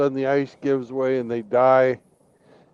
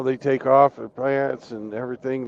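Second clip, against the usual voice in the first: ordinary talk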